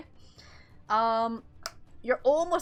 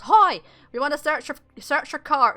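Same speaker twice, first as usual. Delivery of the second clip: loud, raised-pitch guard's challenge; challenging guard; 5 semitones higher, 8 dB louder than usual. Acted. Guard pulls up against the old driver, loud challenge. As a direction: call out like a guard stopping a cart. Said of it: "pulls up against him and goes".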